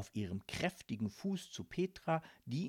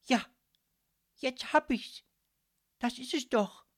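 higher, louder sudden idea; spirited eureka; 10 semitones higher, 5 dB louder than usual. Acted. Little mussel struck by an idea, calling out bravely. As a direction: exclaim it with fresh courage. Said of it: "rief sie beherzt".

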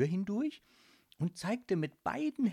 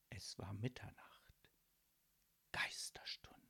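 whispery, much softer and lower; hushed, eerie reveal; 8 semitones lower, 13 dB quieter than usual. A narrator's reading. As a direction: breathe it out like a spooky secret. Hushed midnight reveal in ghost story.

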